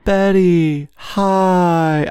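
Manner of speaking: high, breathy society lady greeting; imitating Christine Baranski's Martha May greeting Betty; Martha May Whovier. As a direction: coo the name up high like a glamorous Whoville socialite. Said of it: "I could do her. She goes".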